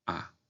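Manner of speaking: "a" very short, at normal pitch; neutral tone. Shown as a demonstration. Teacher practising tones on syllable A, short neutral "a". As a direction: say "a" briefly with no tune. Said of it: "vamos a practicar los tonos".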